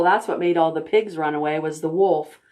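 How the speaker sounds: usual speaking voice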